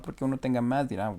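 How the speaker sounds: ordinary delivery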